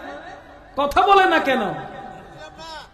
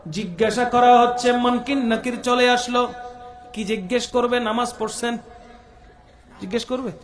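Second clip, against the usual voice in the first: ordinary delivery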